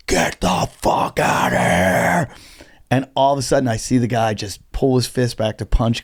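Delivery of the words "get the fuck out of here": strained, voiceless imitation of a scream; stage-scream it slowly without full volume; mock scream. Comic screaming in a heckler's face, retold as hoarse mock scream. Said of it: "screams right in his face".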